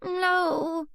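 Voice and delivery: put-on high little girl whimper; a scared toddler's whimpered no; whimpering child. A narrator's reading. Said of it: "she whimpers".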